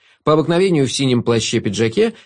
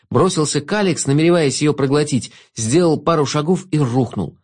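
ordinary delivery, nothing marked

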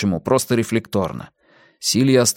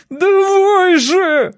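high, long-drawn loud howl; frantic stretched-out cry; 6 dB louder, much slower than usual. A narrator's reading. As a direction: wail it out in desperation. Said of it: "завыл Эрик".

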